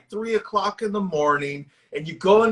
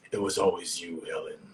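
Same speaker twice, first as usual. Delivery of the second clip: much lower, softer villain murmur; deep Tony Todd impression; 11 semitones lower, 9 dB quieter than usual. Quoting the horror villain to a friend, deep and menacing. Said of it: "he'd actually be like this".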